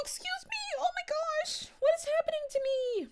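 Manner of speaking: squeaky voice breaks she can't stop; real pitch cracks, startled and apologetic; voice cracking up. Real, not acted. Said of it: "This has been happening all stream".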